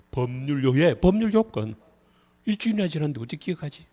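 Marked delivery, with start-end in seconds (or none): 2.4-3.9 quick, raised-eyebrow objection; mock student protest. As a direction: rattle it off like a complaining student. Teacher voices students' protest about remembering. (학생 흉내 내며 빠르게 투덜대듯)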